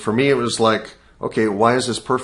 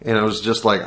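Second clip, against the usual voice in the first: usual speaking voice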